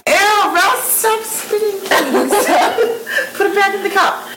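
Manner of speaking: high, laughing disgust; grossed out. Real, not acted. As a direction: squeal in disgust while laughing. Disgusted at spitting dog.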